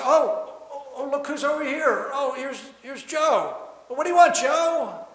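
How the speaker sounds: high, loud, exaggerated surprise at finally noticing someone; mock-surprised God. Preacher voices God finally noticing a loud beggar, mock surprise. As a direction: act out a God suddenly distracted by a caller. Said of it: "maybe I'll get God to say".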